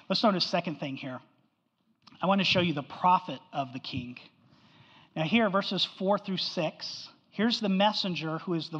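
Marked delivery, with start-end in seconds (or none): none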